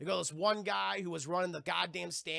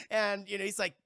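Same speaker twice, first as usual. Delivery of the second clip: ordinary talk, nothing marked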